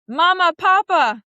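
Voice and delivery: loud, high, slow call of Mama, Papa; calling to parents. Retold or imitated. In the clown class game, calling out to parents. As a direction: cry out like a child to her parents.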